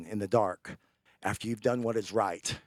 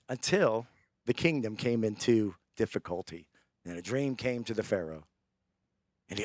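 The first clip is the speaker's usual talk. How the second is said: ordinary talk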